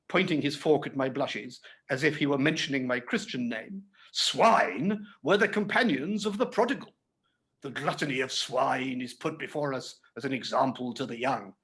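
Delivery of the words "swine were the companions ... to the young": usual speaking voice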